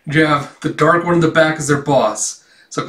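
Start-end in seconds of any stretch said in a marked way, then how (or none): none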